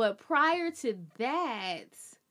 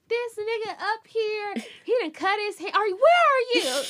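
higher and louder, building to a panicked question; frantic friend; 9 semitones higher, 6 dB louder than usual. Retold or imitated. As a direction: relay the chaos in a rush. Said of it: "she was like".

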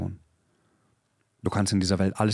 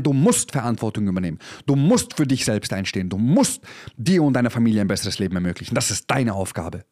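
raised, louder, hammering each word; fiery preaching; 4 semitones higher, 3 dB louder than usual. Acted. Coach to his listeners, done with excuses: loud fiery preaching. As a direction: preach it like a coach who's had enough.

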